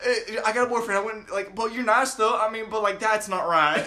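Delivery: high, hesitant imitation of a coworker's excuse; put-on awkward covering up; mock flustered backpedal. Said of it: "she's trying to cover her ass so bad".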